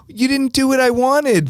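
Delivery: high, whiny pouty complaint; mock a sulking clubgoer; pouty girl. DJ imitating the pouting girl, whiny and high. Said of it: "going with the shrug and the open eyebrows up".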